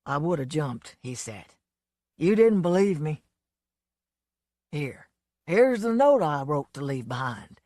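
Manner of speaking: a lightly voiced boozy Southern showman; a hint of a drunk country star, fed up; lightly played drunk blowhard; Lonesome's voice. A narrator's reading.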